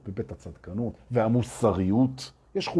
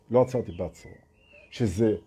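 ordinary talk

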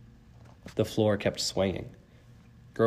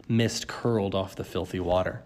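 plain reading voice

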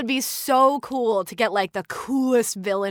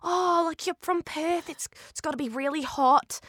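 high, soft gushing reply; higher, softer, awed bystander voice; impressed American stranger; 6 semitones higher, 4 dB quieter than usual. Retold or imitated. Actor imitating people at Disneyland, high soft gushing. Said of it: "And they'll be like".

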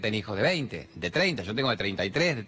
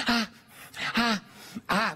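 mock moans; 9 semitones higher, much slower than usual. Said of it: "la otra hace".

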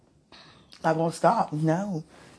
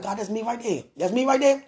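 excited, smitten; 7 semitones higher than usual. Retold or imitated. Telling God that's me right there, excited and smitten. Retells excited claim on admired man.

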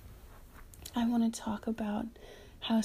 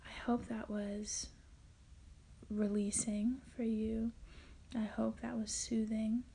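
ASMR whisper; 6 dB quieter than usual. Acted. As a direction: whisper it gently like closing a relaxation session. Closing a soothing self-massage segment.

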